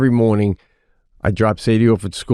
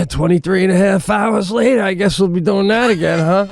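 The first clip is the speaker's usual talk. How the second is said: higher, weary bored dog voice; pet voice; 8 semitones higher than usual. Retold or imitated. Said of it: "it's sitting down going".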